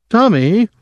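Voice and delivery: a hurt, high cry of his name. A narrator's reading. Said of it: "Dwyer said, hurt".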